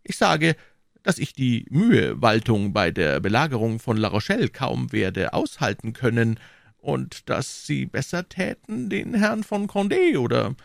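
high, lightly voiced sly cardinal; a scheming cardinal feigning humility; cardinal's voice. A narrator's reading.

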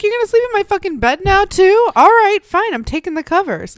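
high, loud indignant haunting voice; angry ghost. Pretend. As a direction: yell like an outraged ghost. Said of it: "Be like".

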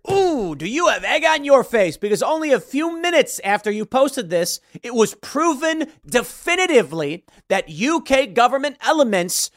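high, emphatic taunting crow; mock gloating. Real, not acted. Taunting a writer who posted too soon, mock gloating. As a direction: rub it in, hitting the words hard.

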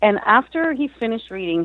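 ordinary delivery

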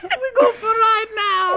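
very high, slow put-on voice; high put-on drawl. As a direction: stretch it out high and silly. Trading silly put-on voices.

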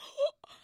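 sharp, very high gasp; shocked gasp. Retold or imitated. Prince says he's calling, a sharp gasp. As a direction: gasp in stunned disbelief.

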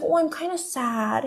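high, slow mock-pouty voice; sad baby act. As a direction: imitate a man whining like a baby. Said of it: "acting like babies and being like".

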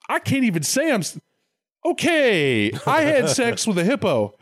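high-pitched, wailing mock anguish; hammed-up dawning horror, half crying; horrified Adam. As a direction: play appalled self-disgust in a high whine. Acted Adam's horrified realization after eating.

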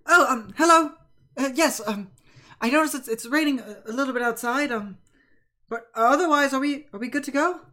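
chirpy-polite character voice with ums; hesitant Ben the sailor. Acted. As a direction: pitch it up, polite and fumbling. Plays Ben, deckhand, hesitant greeting.

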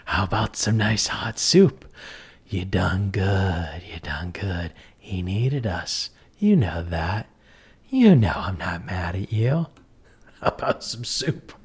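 slow eerie whisper, fading; Ghost Keeper voice. Acted. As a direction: hush it like a creepy innkeeper. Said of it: "I'm gonna stick with the Ghost Keeper".

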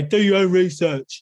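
high mocking quote voice; sneering mock quote; mock flat earther. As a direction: parrot the conspiracy slogan. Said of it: "Flat Earth will say".

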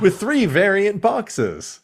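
high, loud and slow ad tagline; slow, punchy tagline from a cereal ad; cereal commercial announcer. Pretend. Continues the cereal ad joke.

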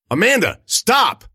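loud, high, frantic cry; shouted plea. A narrator's reading. The wounded girl shouts at Amanda to stop. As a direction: shout her name to stop her.

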